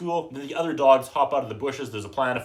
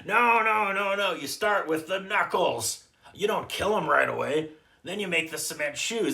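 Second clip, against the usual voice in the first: mob boss; 5 semitones higher than usual. Retold or imitated. Mob boss instructing on phone.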